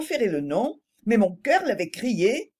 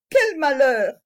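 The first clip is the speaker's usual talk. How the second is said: lamenting; 4 semitones higher, 6 dB louder, slower than usual. A narrator's reading. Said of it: "dis-je lamentablement".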